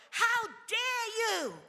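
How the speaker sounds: high, loud and slow, outraged at the officers; a mother's furious challenge, drawn out; indignant retort. Retold or imitated. Mother confronting police officers in retold story.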